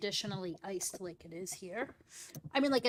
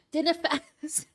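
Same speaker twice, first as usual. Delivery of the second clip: a half-hearted, lightly Scottish "dinner fest", slightly higher; failed Scottish accent; 6 semitones higher, 4 dB louder, slower than usual. Pretend. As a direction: try a Scottish accent and fall short. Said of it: "I can't do an accent".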